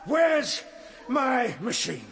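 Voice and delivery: furious demand over the Eloi's chatter; exasperated shout. Acted. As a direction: snap it out in frustration. Traveller shouts again.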